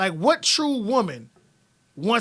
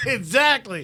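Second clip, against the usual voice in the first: slow, drawn-out word through laughter; laughing agreement; 3 semitones higher, slower than usual. Real, not acted. Host teasing woman about her denial, laughing agreement. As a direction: agree while cracking up.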